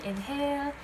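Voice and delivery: slow, calm breathing cues; guided breathing. Acted. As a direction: lead the breath gently. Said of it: "please inhale with me".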